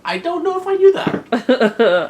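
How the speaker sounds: high, slow amazed; surprised. Real, not acted. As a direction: sound truly amazed.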